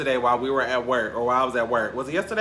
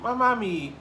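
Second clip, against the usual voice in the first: mock whimper; 10 semitones higher, slower than usual. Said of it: "And I was like".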